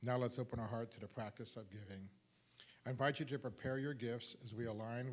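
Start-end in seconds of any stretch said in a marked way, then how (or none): none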